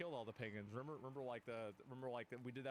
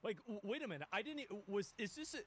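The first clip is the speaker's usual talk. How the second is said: higher, stammering amazement; can't believe this is up for debate; incredulous disbelief; 6 semitones higher than usual. Real, not acted. Streamer reacting to the guest denying colonization, incredulous.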